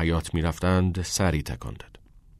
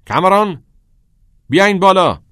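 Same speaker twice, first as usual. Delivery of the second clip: very high, loud call; shouted summons; 12 semitones higher, 11 dB louder than usual. A narrator's reading. Old teacher calling the boys upstairs, shouting. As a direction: shout up the order at full pitch. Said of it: "دکتر هگر فریاد زد".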